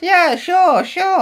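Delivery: very high, sloppy attempt at an American accent; Trump as failed American accent. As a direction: squeak a bad American accent. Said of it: "I can't do an American accent".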